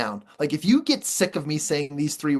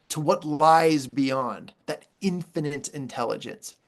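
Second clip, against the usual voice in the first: normal talking voice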